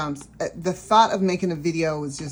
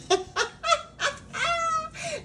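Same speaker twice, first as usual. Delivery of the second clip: high delighted laugh; 5 dB quieter than usual. Real, not acted. Laughing about the highlighted price.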